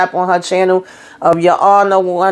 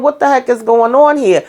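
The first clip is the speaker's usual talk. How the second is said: ordinary delivery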